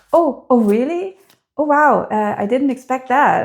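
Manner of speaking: high, bright little gasp of surprise, imitating; nervously happy surprise. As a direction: act pleasantly caught off guard, a bit nervous. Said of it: "nervously happy".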